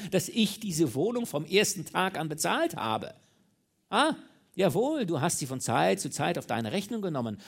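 high, loud torrent of outrage; furious outburst. A narrator's reading. Mistress who paid for the flat, furious outburst. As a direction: let the rage burst out, punching each point. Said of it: "Tür ihrer Wut eingebrochen".

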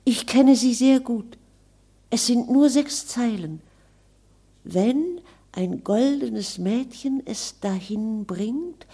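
put-on bright, high girl's voice, quick and pert; a spirited girl talking back; lively young girl. Virginia recites the prophecy. (helles, lebhaftes junges Mädchen)

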